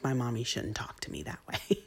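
whispered, mock child voice; imagined son's protest. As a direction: hush it like a small hurt boy. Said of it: "he should have been like".

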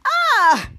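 short high loud yelp; a zapped cartoon cry; mock pain cry. Miles zapping Ray, a high loud ah. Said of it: "hits him and said".

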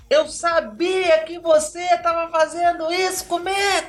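high, drawn-out accusing yell; crazed screaming. Retold or imitated. Wife cursing her husband on the phone, screaming wildly. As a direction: scream each insult out slowly, way up high. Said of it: "começou a gritar com ele loucamente".

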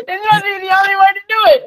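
words broken by laughter; laughing through words. Real, not acted. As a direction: laugh while repeating the quote. Repeating quote while laughing.

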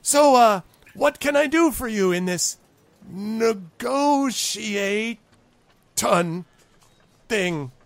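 high goblin struggling through negotiate-ton; proud high voice, halting on the long word; painstakingly rehearsed word. Acted. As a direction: break the big word into slow, separate pieces. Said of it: "he's been working on this".

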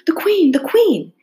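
high, loud warning call. A narrator's reading. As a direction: shout the alarm across the garden. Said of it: "called out".

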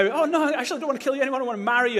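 high, fast mock lover's gush; mock reconciled lover. Preacher mocking a TV-show couple, high and fast. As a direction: ham up a sudden change of heart.

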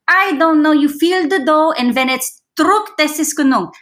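German grandma shrugging. Retold or imitated. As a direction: play Oma who cooks by feel, not cups. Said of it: "And she's like".

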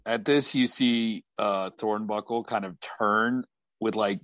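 ordinary delivery

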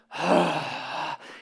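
a long, weary put-upon sigh; exasperated sigh. Retold or imitated. Dad imitates his son on the couch, weary sigh. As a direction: let the air out like a fed-up kid. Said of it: "he's like".

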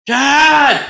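high, loud imitated scream. Imitating a tripping housemate calling for Dad, high scream. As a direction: scream out for your father. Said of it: "started screaming out".

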